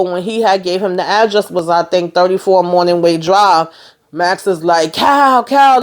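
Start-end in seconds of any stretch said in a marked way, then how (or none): 4.9-5.8 higher, louder desperate call. Retold or imitated. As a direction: shout his name to stop him. Max calling out to Cal.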